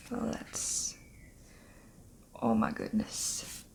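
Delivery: breathless, near-silent whisper holding back tears; overwhelmed whisper. Real, not acted. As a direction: barely get the words out, choked up. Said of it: "I'm gonna cry".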